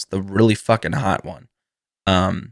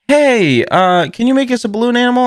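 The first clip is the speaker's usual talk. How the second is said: put-on high, fast eager kid asking; squeaky little kid begging quickly; kid's voice; 13 semitones higher, 3 dB louder than usual. Kid asking the balloon guy, high and quick. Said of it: "a kid and his father came up and was like".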